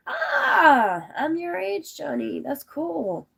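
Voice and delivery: high-pitched happy exclamation; long bright "Ah", then pleased high chatter; delighted. Real, not acted. To Johnny on finding they share an age, delighted. Delighted discovery about a viewer.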